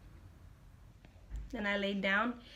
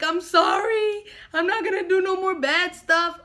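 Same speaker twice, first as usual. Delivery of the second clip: retold tearful plea; 6 semitones higher, 4 dB louder than usual. To God, high-pitched tearful begging. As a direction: beg for forgiveness like you're back in that moment. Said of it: "I would cry".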